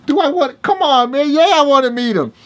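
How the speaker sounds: loud, very high eager outburst; excited eagerness. Retold or imitated. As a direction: act out jumping at the chance, voice way up. Acts out eager reply to uncle.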